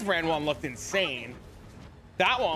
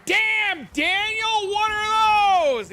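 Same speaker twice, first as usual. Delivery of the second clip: high, loud mock meme yell "Damn, Daniel"; meme shout; 8 dB louder, much slower than usual. Dad teasing his son with a loud high meme voice. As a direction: belt it out like the viral video. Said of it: "And I said".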